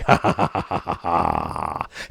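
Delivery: low mock-evil cackle; a low scheming laugh; villainous chuckle. Gamer cackling at rivals' ruin, low mock-evil chuckle.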